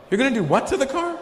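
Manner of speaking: high-pitched, baffled query; acted astonishment, pitched high; incredulous question. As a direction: soar up in disbelief. Baffled by accented "park.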